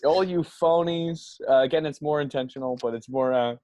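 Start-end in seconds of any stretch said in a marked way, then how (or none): 0.0-1.4 put-on slow, high, loud drunken sneer; drunken rant. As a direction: drag it out like a mocking drunk.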